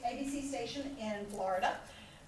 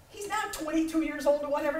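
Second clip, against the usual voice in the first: normal talking voice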